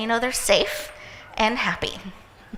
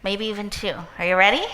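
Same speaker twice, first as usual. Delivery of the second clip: ordinary talk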